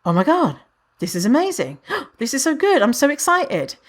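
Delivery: gushing newcomer, rising high with a gasp; acted excitement. Newcomers discovering fat liberation, gushing with acted excitement. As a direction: imitate someone thrilled by a new discovery. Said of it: "people come to fat liberation and they're like ... I'm so excited".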